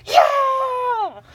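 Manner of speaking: loud high yell; shout. Retold or imitated. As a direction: belt it out. Impression of shouting.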